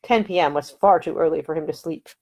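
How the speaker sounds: plain reading voice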